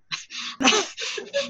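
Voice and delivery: high, soft burst of laughter; a quick rueful laugh up high; short laugh. Real, not acted. Laughs mid-thought about pain.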